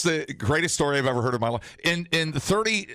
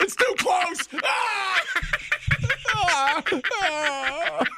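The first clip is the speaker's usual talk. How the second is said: high shrieking panic; mock scream; much slower than usual. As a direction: scream as if the screen's too close. Pretends the screen is too close.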